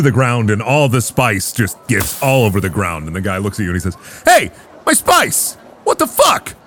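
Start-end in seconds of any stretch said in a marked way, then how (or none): 4.2-6.5 angry merchant. Acted. GM voices angry spice seller.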